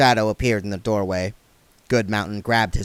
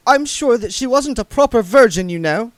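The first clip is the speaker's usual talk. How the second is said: put-on prim young lady voice; 14 semitones higher, 7 dB louder than usual. A narrator's reading. Saccharissa voiced.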